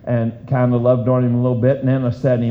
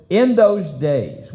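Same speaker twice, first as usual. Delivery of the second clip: slow scripture reading; 4 semitones higher, slower than usual. Real, not acted.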